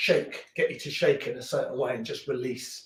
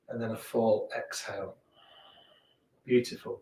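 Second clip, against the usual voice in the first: guided breathing; 3 semitones lower, 6 dB quieter than usual. Acted. Calm guided breathing cues.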